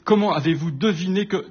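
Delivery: stupéfait, plus aigu, coupé net; s'étonner, voix qui monte; Watson stupéfié. Acted. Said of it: "fis-je, stupéfié". (Watson stupéfait interroge Holmes, plus aigu)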